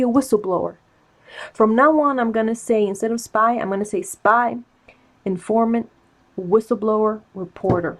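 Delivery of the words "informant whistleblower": ordinary delivery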